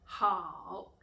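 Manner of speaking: ordinary delivery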